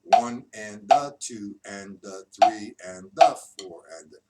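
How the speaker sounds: loud on one and three, nearly silent on two and four; accent one and three. Shown as a demonstration. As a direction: punch the one and the three, let the others fall away. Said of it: "play the one, the, and the three".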